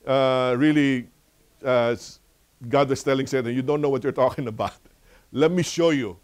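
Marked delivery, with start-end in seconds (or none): 3.5-6.2 higher, louder confident challenge; God rebuking. Retold or imitated. God answers Satan before showing him, loudly rebuking. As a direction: put Satan firmly in his place.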